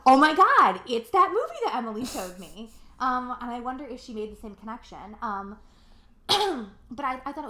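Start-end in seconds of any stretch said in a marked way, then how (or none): 0.1-2.7 loud, high burst of recognition; squealing oh my God, then laughing; excited gasp. Retold or imitated. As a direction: blurt it out, thrilled to recognise it. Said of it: "I was like".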